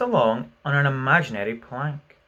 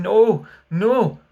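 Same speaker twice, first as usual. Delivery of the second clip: cowering plea; 8 semitones higher, 9 dB louder than usual. A narrator's reading. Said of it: "cowered before his captain, crying".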